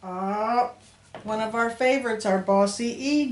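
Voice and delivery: ordinary talk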